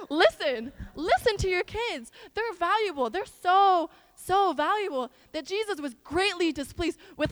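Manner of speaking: loud, high, fervent sermon delivery; impassioned preaching. Real, not acted. Preaches at full force.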